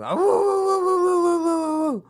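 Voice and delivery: loud, high-pitched hooting woos; high woo calls. Retold or imitated. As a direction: hoot like a hype man. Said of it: "Cozy literally just walks around my work going".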